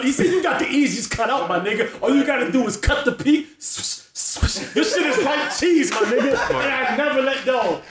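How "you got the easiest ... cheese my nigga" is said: high-pitched, laughing imitation of an indignant friend; mock exasperation. Friend telling his pal the haircut is simple, mock exasperated. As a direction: pile on the mock outrage, stretching the punchline. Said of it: "Doc got so mad".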